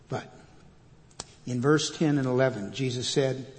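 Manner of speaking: ordinary talk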